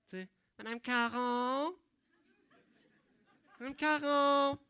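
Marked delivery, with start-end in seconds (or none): 0.6-1.8 slow, lightly voiced schoolkid asking; a pupil trying to catch the teacher; polite pupil call. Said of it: "Tu sais, cet élève-là". 3.6-4.6 higher, louder schoolkid calling again; the kid won't be ignored now; insistent pupil call. Acted. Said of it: "Tu sais, cet élève-là".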